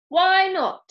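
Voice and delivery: high, loud challenge; sharp demand. A narrator's reading. Mister Parker challenges Ben at the door, demanding. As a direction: fire the question back loudly.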